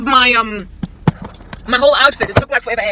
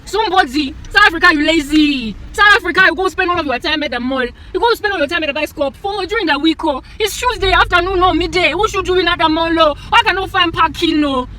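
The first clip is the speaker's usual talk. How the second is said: raised, loud, fed-up scolding; high exasperated rant; 6 semitones higher, 4 dB louder than usual. Real, not acted. Driver ranting at crowded mall, high and exasperated. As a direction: scold the whole country in frustration.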